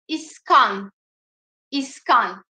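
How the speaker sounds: İskan with a long, open î sound; İskan, the Kurdish î; model word. Shown as a demonstration. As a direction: say İskan clearly for learners to repeat. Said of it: "Kurdish İ ... şapkalı olan harf".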